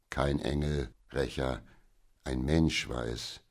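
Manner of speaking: low, calm fatherly voice; gentle correction. Acted. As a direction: correct her softly and soberly. Father gently corrects his excited daughter.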